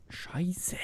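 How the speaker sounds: whisper. Real, not acted.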